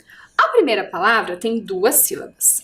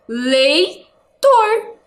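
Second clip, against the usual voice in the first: "Leitor" broken into lei, tor, pitched up; syllable-by-syllable reading; 5 semitones higher than usual. Shown as a demonstration. Teacher reading the last word with pupils, "Leitor" split apart. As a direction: read "Leitor" in two high, separate pieces. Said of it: "Vamos ler?".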